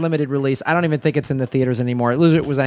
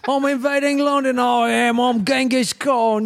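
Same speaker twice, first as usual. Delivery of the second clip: mock British Genghis Khan; 9 semitones higher than usual. Joking to friends in a hammed-up British accent. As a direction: play Genghis Khan as a Londoner.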